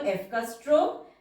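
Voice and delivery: ordinary talk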